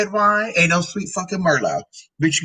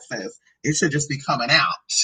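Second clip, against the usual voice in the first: normal talking voice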